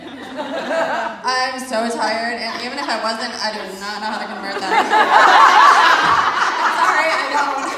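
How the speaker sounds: loud words breaking into a big laugh; real laughing. Real, not acted. Nurse gave kilograms to an exhausted mother, laughing. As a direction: talk through helpless laughter.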